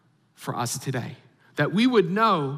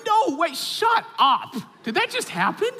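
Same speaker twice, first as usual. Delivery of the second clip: high-pitched, hammed-up amazement; astonished disbelief; 11 semitones higher than usual. Dad reacting to the song coming on, astonished disbelief. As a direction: act floored, voice shooting up. Retold shock at answered prayer.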